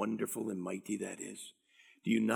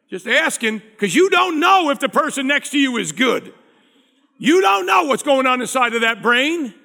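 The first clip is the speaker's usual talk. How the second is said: loud, high, urgent preaching to the whole church; full-force preaching; 12 semitones higher, 14 dB louder than usual. Acted. Pastor urging the church to forgive, loud preaching. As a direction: drive it home to the congregation at full force.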